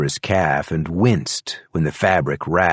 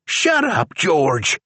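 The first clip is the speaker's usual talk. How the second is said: high, sharp retort; deputy silencing the prisoner; Charlie's voice; 9 semitones higher, much slower than usual. A narrator's reading. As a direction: snap it, pitched up. Charlie snapping at George.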